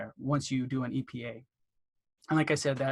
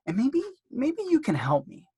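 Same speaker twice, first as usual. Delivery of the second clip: much higher, sugary ask for help; sweet phone voice; 10 semitones higher than usual. Shown as a demonstration. Dentist asking the operator a favour, sweetly higher. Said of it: "And then you kinda pause and wait".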